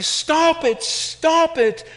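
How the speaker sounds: high, loud and very slow plea; crying out. Acted. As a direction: cry out to make it stop. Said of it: "I cried".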